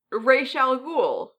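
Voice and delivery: "Ra's al Ghul" with Ra's said like "raysh"; variant pronunciation. Shown as a demonstration. Host offers listeners one way to say the villain's name, Ra's as "raysh". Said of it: "how they want to pronounce".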